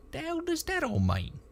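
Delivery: high-pitched baffled trooper; confused. A narrator's reading. Trooper asking the techie about the text, confused. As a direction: ask it high and puzzled, in dialect. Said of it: "looked up at Tink in confusion".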